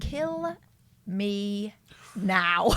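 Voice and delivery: KILL, ME, NOW as separate words, building to a loud NOW; bold-writing read-out. Retold or imitated. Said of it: "in very bold, big writing".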